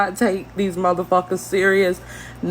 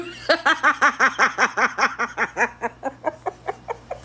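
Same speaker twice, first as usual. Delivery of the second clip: crying laughing; 4 semitones higher, 4 dB quieter than usual. Real, not acted. In tears over the video, wheezing laugh. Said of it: "I'm literally crying".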